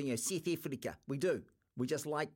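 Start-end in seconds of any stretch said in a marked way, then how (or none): 0.2-1.0 a quick, light South African accent on "South Africa", vowels shifted to "Sith Iflika". Shown as a demonstration. Radio host showing listeners how Kiwis mimic South Africans, in a light South African accent. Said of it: "we wanna go into that South African accent".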